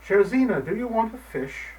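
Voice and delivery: put-on old man coaxing his cat; coaxing call. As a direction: tempt the cat with a fish. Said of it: "the old man said these words".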